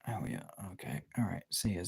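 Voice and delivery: normal talking voice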